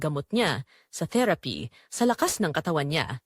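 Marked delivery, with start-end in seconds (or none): none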